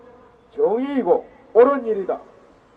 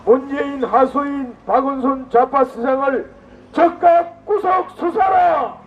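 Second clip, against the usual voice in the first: roaring rallying speech; 3 semitones higher than usual. Real, not acted. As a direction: bellow the demand to the crowd. Rally leader calls crowd chant.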